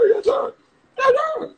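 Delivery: very high, comic put-on voice; playful acted reply. Streamer voicing the picture talking back to him, playfully high. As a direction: squeal it high with a laugh in it.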